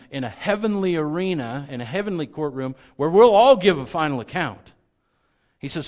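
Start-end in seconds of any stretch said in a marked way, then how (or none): 0.1-4.7 loud, raised sermon voice; emphatic preaching. Real, not acted. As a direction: press it home to the congregation, high and loud.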